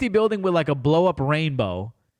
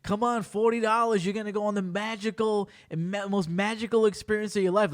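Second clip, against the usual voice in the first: higher-pitched mock sales hype; hyping it up; 5 semitones higher, 3 dB quieter than usual. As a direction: sell it like an eager promoter, imitated. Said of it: "they were hyping it up".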